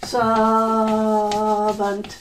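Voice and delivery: Servant said slowly and held long for learners; slowly drawn-out word. Shown as a demonstration. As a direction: draw out Servant so the class can copy it. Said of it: "this is his servant".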